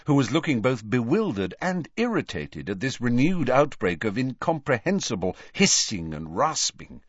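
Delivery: usual speaking voice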